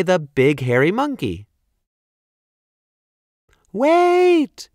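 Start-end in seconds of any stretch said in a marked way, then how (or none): 3.7-4.7 very high ringing call; shouting princess. Acted. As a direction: call after him desperately. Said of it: "she shouted".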